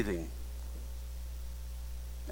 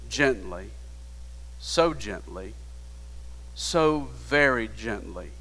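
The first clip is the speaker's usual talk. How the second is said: softer and softer, lingering on gently; tender hush; 5 semitones lower, 6 dB quieter than usual. A narrator's reading. Preacher lingering tenderly on the old man's gentle hands. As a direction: ease each gently down gently.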